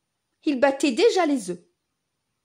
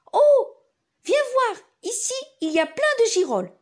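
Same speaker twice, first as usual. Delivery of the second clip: excited; 12 semitones higher, 6 dB louder than usual. Acted. Father calls excitedly.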